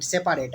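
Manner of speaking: "सेपरेट" as "से-पा-रेट"; spelling pronunciation "सेपारेट"; said as written. Shown as a demonstration. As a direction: keep the "पा" full in "सेपारेट". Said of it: "ऐसे याद रखिएगा".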